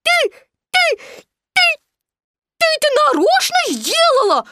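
high piglet stammer bursting into accusation; spluttering. A narrator's reading. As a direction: splutter single words through soapy water, then squeal. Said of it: "булькнул было Пятачок". (захлёбывающийся писк)

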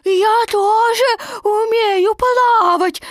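high, loud proud little boast; a small joey showing off; proudly. A narrator's reading. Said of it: "гордо сказал Крошка Ру".

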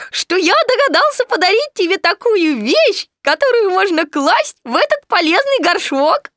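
high, squeaky, singsong delight; joyful Piglet. A narrator's reading. Piglet is pleased his gift fits the pot, squeaky joy. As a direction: squeak it out happily. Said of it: "радостно сказал Пятачок".

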